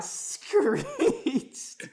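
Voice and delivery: high, loud, drawn-out word; mock screech. Narrator imitating the demon's screech, high and drawn out. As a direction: stretch the word into a screech, laughing.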